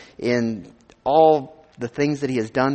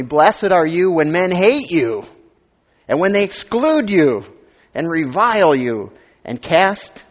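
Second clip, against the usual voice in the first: loud, slow pulpit reading of scripture; preaching scripture reading; 3 semitones higher, 4 dB louder, slower than usual. Acted. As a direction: proclaim each blessing to the congregation, slowly.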